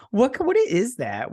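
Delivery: high, drawn-out mock bafflement; incredulous question. Viewer questioning a strange accent, acted high-pitched bafflement. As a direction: puzzle over it, pitch jumping up.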